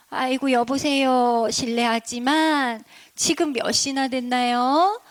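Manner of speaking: put-on slow, drawn-out old lady asking politely; lightly acted granny voice; storybook granny. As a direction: stretch the words like an elderly grandmother. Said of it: "할머니께서 시장에 가셔야 되는데".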